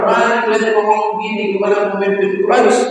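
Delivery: fast, high, sing-song indignation; Abu Bakar's rebuke. Retold or imitated. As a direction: rattle off a high scolding. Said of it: "Oh, kata Abu Bakar".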